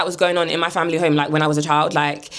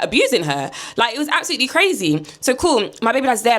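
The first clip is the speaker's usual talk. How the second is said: usual speaking voice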